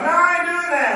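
high, loud mock refusal; hammed-up stubborn Naaman.

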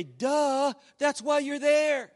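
a slow, teasing duh, like it's obvious; mock duh. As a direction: drag it out as if stating the obvious. Mocking reply to a churchgoer.

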